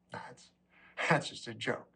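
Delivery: low, quiet, with a little laugh; soft laughing aside; chuckling disclaimer. Real, not acted. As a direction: brush it off softly through a chuckle. Brushes off shocking line, then insists.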